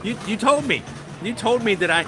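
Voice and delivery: high-pitched mock indignation; insist, high, like a child let down; retold squeal of protest; disbelieving protest.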